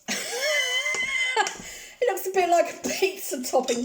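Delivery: laughing through the words, pitch jumping up. Real, not acted. Cook laughs at her stringy cheesy dish to viewers. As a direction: giggle at the stringy cheese as you say it.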